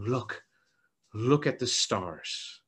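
soft, low, slow comforting words; gentle hush. Acted. God gently showing Abraham the stars, soft and low.

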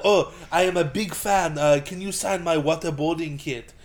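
mock Israeli ex-agent, slow opening; Israeli accent. Fan asking for an autograph, imitated Israeli accent. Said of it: "he was playing the Israeli".

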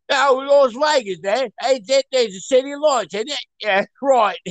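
high, exaggerated Cockney cab driver; thick hammed-up Cockney greeting; severe Cockney. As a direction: do an over-the-top chirpy London cabbie in Cockney. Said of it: "he was a severe Cockney".

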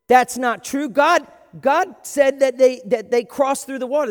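put-on loud, high, eager child's protest; excited kid. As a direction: shout it like a cocky little kid. Kid objects to teacher.

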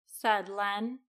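ordinary delivery, nothing marked

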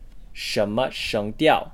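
slow repeat of "什么声调", rising, neutral, level, then falling; demonstrated Mandarin tones. Shown as a demonstration. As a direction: say "什么声调" slowly with each tone distinct. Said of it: "The tone order of this sentence".

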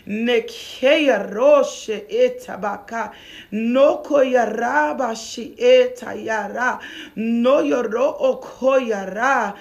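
slow, rolling chant-like prayer syllables; praying in tongues. Real, not acted. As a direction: pray slowly in tongues with drawn-out vowels. Prays in tongues mid-sermon.

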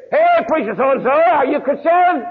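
high, loud call blared out; loudspeaker shout. Retold or imitated. Man calling at pastors' houses from a car loudspeaker, shouting. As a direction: bellow it like over a loudspeaker. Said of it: "he's got a loudspeaker on top".

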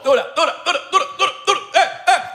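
high, repeated word bouncing like an echo; echo effect. Pretend. Host hyping the singer over the mic, high echo effect. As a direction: chant it high into an echoing mic. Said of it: "Ah, el eco".

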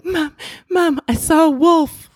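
put-on high, slow frightened little girl voice; scared child. Daughter telling mom she saw a wolf, high and scared. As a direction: plead like a spooked kid at bedtime.

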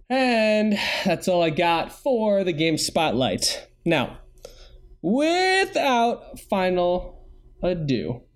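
slow, drawn-out show announcer. Acted. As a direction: hype up the segment like a host. Hosts announce the final segment.

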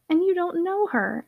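drawn-out, astonished cry; exceeding surprise. A narrator's reading. Jessie can't believe her sister never asked the name, amazed. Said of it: "exclaimed Jessie in a tone of exceeding surprise".

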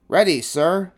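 high, loud, snappy reply; shouted. A narrator's reading. Stuart answers the referee before the race, shouting. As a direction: call back like an eager sailor. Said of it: "shouted Stuart".

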